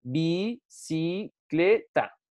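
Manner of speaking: "Bicicleta" slowly broken into bi, ci, cle, ta; syllable by syllable. Shown as a demonstration. Teacher divides the word for the class, syllable by syllable. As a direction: say "Bicicleta" one drawn-out syllable at a time. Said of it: "la dividimos".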